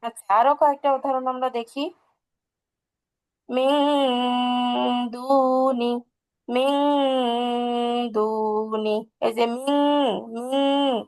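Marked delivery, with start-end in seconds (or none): 3.5-11.0 মিন said as a long humming "ming"; hidden nasal ghunna. Shown as a demonstration. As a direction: recite মিন দুনি slowly, n held in the nose. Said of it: "নাকের ভিতরে লুকাইলে অনিশ্বারের মতো".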